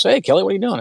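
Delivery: imitating a buddy's upbeat, high-pitched hello; cheery phone greeting. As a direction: greet a friend breezily, pitched well up. Friend's cheery greeting on phone, retold.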